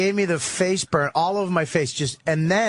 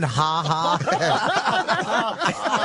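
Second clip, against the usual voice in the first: robotic typed laugh. Retold or imitated. Typed fake laugh imitated.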